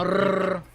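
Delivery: Rrr with a hard trilled R; rolled R. Shown as a demonstration. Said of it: "you can do the hard R".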